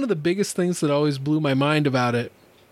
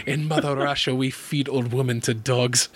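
mock-heavy Russian accent; deadpan threat in a Russian accent. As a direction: put on a joke Russian accent. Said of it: "she could have said with that accent".

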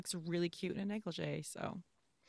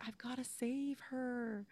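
high, drawn-out, hushed mock heroics; desperate film hero; 7 semitones higher, 6 dB quieter, slower than usual. Host mimics Cary Grant's big line, mock desperate.

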